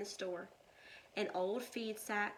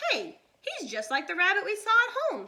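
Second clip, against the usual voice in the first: put-on boy voice; 11 semitones higher, 11 dB louder, faster than usual. A narrator's reading. Jack voiced as young boy.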